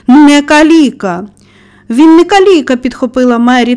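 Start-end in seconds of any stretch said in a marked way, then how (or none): 0.1-1.3 slow, loud, higher denial; angry exclamation. A narrator's reading. Boy insisting on it to the gardener, angry. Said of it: "розгнівано вигукнув хлопець". 1.9-2.8 high, loud indignant retort; angry. A narrator's reading. Girl defending the boy, angry. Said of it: "також неабияк розсердившись".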